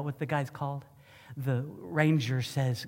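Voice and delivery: normal talking voice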